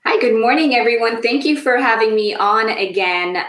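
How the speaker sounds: usual speaking voice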